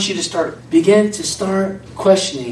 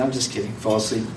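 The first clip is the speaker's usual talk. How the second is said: laughing; 7 semitones lower, 6 dB quieter than usual. Real, not acted. Jokes about the congregation dozing.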